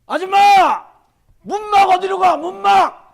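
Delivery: high, loud shout out the car window. Retold or imitated. Lost driver yelling to a woman for the way, loud shout. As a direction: yell to a woman on the road for directions. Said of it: "큰 소리로".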